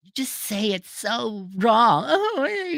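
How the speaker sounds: slow, high-pitched mock complaint; mock baby whiner. Mocking critics who dislike his tone, high whiny voice. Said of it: "tell me you're a little baby child".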